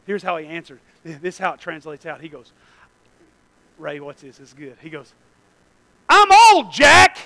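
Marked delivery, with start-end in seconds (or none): 6.1-7.2 loud, high, exasperated shout; old man's indignant retort. Acted. Preacher voicing Zechariah answering the angel, indignant shout. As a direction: bellow it in disbelief at the news. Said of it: "that's how he said it".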